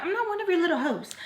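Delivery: high, fast retold comeback; sassy retort. Retelling how she talked back in a quarrel, high sassy retort.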